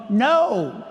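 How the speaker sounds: mild mock scream into the phone; a drawn-out, half-yelled refusal; retold scream. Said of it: "screaming into the phone".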